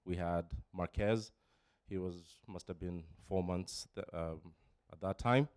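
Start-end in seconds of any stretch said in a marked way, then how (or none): none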